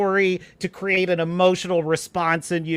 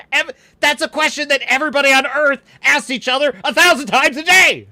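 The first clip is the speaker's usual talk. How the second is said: raised pitch, louder, exasperated disbelief; incredulous outburst; 7 semitones higher, 6 dB louder than usual. Real, not acted. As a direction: build to a loud, high, disbelieving finish. Incredulous rant at silly dialogue.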